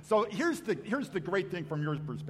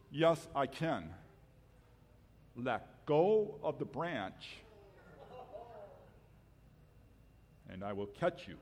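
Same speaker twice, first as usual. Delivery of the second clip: calm voice of God; slower than usual. Acted. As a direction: speak with unhurried calm. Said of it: "I'm God".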